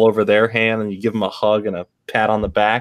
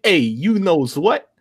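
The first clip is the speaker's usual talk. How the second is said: higher, slow put-on accent; hammed-up type-of-guy accent; imagined character voice; 7 semitones higher, much slower than usual. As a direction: stretch it out like a cartoon tough guy. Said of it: "I just picture him with that voice".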